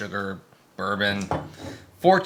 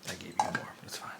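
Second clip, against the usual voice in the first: whisper; 15 dB quieter, faster than usual. Real, not acted. Aside while pouring beer.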